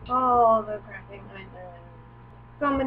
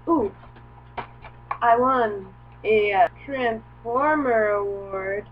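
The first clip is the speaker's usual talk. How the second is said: slow, loud mock boasting; mock proud; 8 dB louder, slower than usual. Vlogger boasting of a silly award, slow and loud. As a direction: announce it like a big prize.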